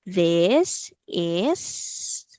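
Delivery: stretched-out, separate "This ... is" for a learner; slow demonstration. Shown as a demonstration. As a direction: say "This is" slowly, word by word. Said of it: "Let's say it slowly".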